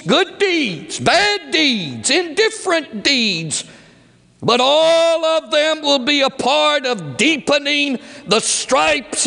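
high, ringing warning of judgment; preaching at full force. Acted. Preacher warning sinners of hell, high and ringing.

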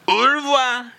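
hammed-up farewell. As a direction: fling out au revoir theatrically. Repeated farewell.

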